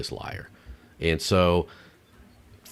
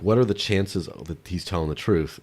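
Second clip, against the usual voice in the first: ordinary delivery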